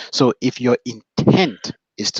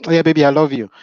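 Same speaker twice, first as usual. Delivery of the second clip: put-on louder, higher, tossed-off "Oh yeah, baby, I love you"; careless, offhand; 5 semitones higher, 7 dB louder, faster than usual. Husband answering wife from the TV, dismissive and offhand. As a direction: brush it off without looking away from the screen. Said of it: "you're watching the T V".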